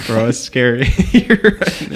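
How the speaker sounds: half-laughing through real nerves; nervous laughing. Real, not acted. Tornado siren during recording.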